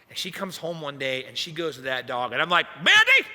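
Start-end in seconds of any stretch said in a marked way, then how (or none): 2.8-3.3 sudden high, loud shout of a name; hammed-up yell at the top of the voice; exaggerated angry yell. Fed-up husband calling out his wife, exaggerated yell. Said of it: "I probably didn't sound exactly like that".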